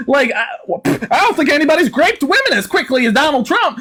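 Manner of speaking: high, twangy yokel put-on; hillbilly interrogator. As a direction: grill them like an exasperated country bumpkin. Mock hillbilly host argues.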